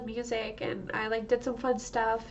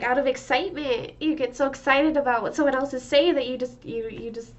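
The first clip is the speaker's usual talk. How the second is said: normal talking voice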